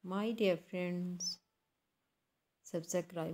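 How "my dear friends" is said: normal talking voice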